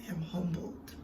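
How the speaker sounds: near-whisper, barely voiced; humbled, moved. Real, not acted. Remembering the encounter with God, humbled and hushed. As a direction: let it sink to a soft, real whisper.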